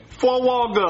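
"Frog" said as "fuh-wog-uh"; low "Frog" with W for R; mispronounced frog. Acted. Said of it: "There's no W".

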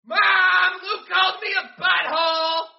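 high gleeful shouting; excited kid shout. Retold or imitated. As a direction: yell it like a thrilled kid. Kid yelling to mom.

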